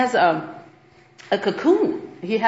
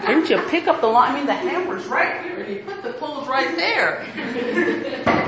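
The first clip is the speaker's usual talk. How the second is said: mock nagging complaint, laughing through it; hammed-up exasperated mom, half laughing; nagging wife; 6 semitones higher than usual. Mama griping at her husband about chores, lightly mocking and laughing. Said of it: "if you are a mama like me and a wife".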